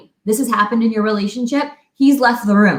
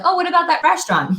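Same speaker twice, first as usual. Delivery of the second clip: quick, higher-pitched eager chatter; chirpy question; 7 semitones higher, faster than usual. Retold or imitated. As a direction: bubble over with a passing idea. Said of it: "I'm like".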